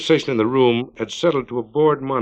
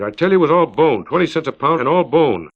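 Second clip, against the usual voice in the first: loud, high angry complaint; angry woman; 5 semitones higher, 5 dB louder than usual. Acted. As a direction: scold like a cheated customer. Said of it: "an angry woman's voice issued".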